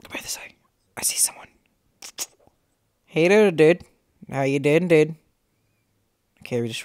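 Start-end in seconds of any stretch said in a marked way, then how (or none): 0.0-1.5 hushed whisper, voice off. Real, not acted. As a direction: whisper to stay hidden. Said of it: "Why are you whispering?".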